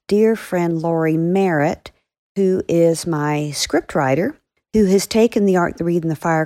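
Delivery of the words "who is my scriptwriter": usual speaking voice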